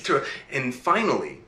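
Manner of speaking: ordinary delivery, nothing marked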